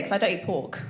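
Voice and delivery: normal talking voice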